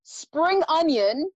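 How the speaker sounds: slow, careful word reveal; slow deliberate reading. Shown as a demonstration. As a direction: drag each word out for suspense. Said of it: "you have the words".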